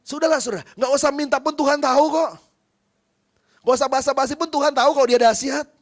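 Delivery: fervent pulpit cry; full-force preaching. Real, not acted. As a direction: lift the voice and drive it at the congregation.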